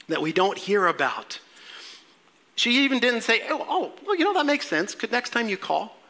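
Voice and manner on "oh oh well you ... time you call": high-pitched, sarcastic acceptance; light, high mock-agreeable mother; mock understanding. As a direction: pretend it all makes sense, pitched up.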